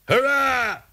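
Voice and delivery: very high, full-out cheer; high-pitched rousing hurrah; crew's yell. A narrator's reading. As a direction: shout it like a whole pirate crew. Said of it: "yelled the crew".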